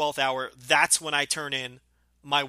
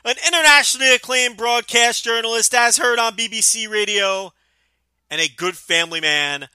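loud, higher, hammed-up announcer; grandiose announcer boast; 7 semitones higher, 8 dB louder than usual. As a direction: boom out your own grand intro. Grandiose self-introduction shouted.

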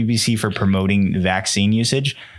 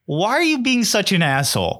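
exasperated quote; 10 semitones higher than usual. Retold or imitated. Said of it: "And I'm like".

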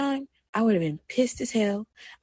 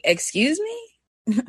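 slow, higher, indignant excuse me; stretched-out, higher indignant excuse me; offended excuse me; 7 semitones higher, much slower than usual. Retold or imitated. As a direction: draw it out as if insulted. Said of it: "and I'll be like".

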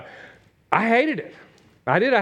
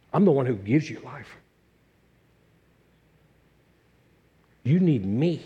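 gentle Jesus voice; 4 semitones lower, 3 dB quieter than usual. Acted. Pastor voicing Jesus' words.

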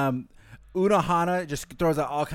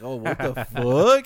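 slow, louder mock outburst; mock outrage; 7 dB louder, slower than usual. Kenpachi voiced reacting to her trick, mock outrage. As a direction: ham up an offended "what the fuck".

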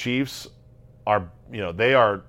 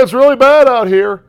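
hammed-up reporter; 13 semitones higher, 13 dB louder than usual. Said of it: "hamming it up".

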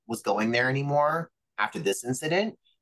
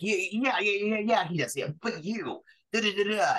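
high mocking dismissive yeahs; sing-song brush-off, pitched way up; mock the bookers waving it off; mocking impression; 9 semitones higher than usual.

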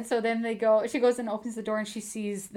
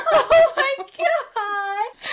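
high, drawn-out laughing squeal; gushing squeal; 13 semitones higher, 7 dB louder, much slower than usual. Real, not acted. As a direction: squeal it out, loud and high, overcome with delight. Gushing over the book's romance.

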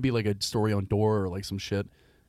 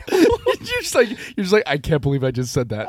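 real laughter through a teasing imitation; laughing mock disbelief; 6 semitones higher, 6 dB louder, slower than usual. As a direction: crack up while voicing a friend's thought. Listener laughing while voicing friend's thought.

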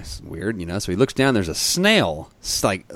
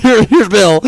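mock announcer intro; 12 semitones higher, 10 dB louder than usual. Joking handoff, loud high.

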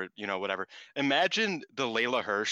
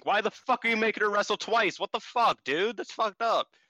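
higher-pitched mock outrage; mock angry yelling; 9 semitones higher than usual. Wrestlers yell at Rossy about a double match, mock outrage. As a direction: retell them yelling at the boss, hammed up. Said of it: "They start yelling at Rossy".

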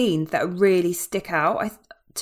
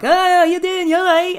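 much higher, bright mock realization; Irish soldiers' realization; 11 semitones higher, much slower than usual. Irish soldiers switching sides against the Scots: high mock realization. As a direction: act suddenly won over, like old mates. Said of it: "they're like".

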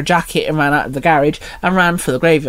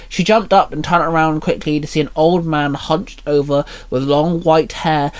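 plain reading voice

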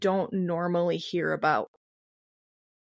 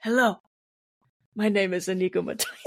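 put-on lightly Spanish-accented swordsman; hint of a movie Spanish accent; Inigo Montoya; faster than usual. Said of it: "six-fingered man".

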